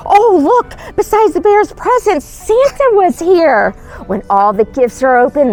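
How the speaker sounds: ordinary delivery, nothing marked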